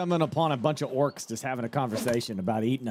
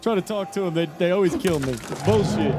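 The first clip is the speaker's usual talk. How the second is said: higher, louder mock complaint of a chat viewer; frustrated chatter; 5 semitones higher, 3 dB louder than usual. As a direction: whine like a fed-up player. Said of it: "there'd be people in chat saying".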